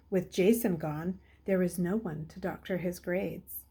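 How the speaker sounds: ordinary delivery, nothing marked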